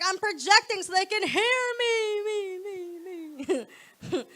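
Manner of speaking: slow, fading echo on me, me, me; mock projecting echo. Comic explaining her yelling, with a hammed-up fading echo. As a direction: project then let it echo away. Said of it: "I'm like".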